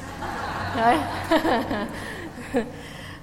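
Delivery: real embarrassed laugh at herself; a short self-conscious laugh; nervous laugh. Real, not acted. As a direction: laugh off the nerves. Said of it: "this is making me anxious".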